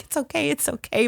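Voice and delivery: quiet retold comfort; reassuring. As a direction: imitate a groom gently calming his bride. Groom reassuring crying bride, retold.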